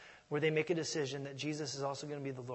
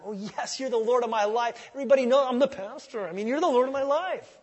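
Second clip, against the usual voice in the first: mock self-assured excuse; 7 semitones higher, 9 dB louder than usual. Pastor mocking his own excuses.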